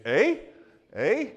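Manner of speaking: high, loud "Eh?" with a rising tune; Canadian eh. Shown as a demonstration. Pastor teasing his congregation with a mock Canadian "Eh?". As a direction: tack a mock Canadian "Eh?" on the end. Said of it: "at the end of everything you say is".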